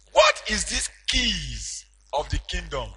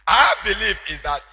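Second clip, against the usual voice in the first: loud, higher preaching surge; preaching at full force; 5 semitones higher, 7 dB louder than usual. Acted. Preacher telling congregation of Peter, in full preaching cry. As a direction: lift the church with it. Preaching climax over music.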